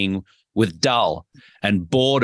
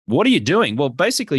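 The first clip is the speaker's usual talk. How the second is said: ordinary talk, nothing marked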